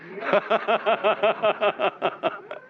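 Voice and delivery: long, rapid rolling belly laugh; hearty laugh. Real, not acted. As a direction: laugh heartily at being found out. Amused by audience having read his books.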